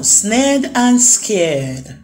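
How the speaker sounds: plain reading voice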